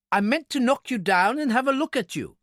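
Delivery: high-pitched, exasperated little man; impatient explanation. A narrator's reading. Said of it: "he explained impatiently".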